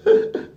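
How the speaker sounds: high burst of laughter; a sudden high laugh. Real, not acted. Laughs at own joke.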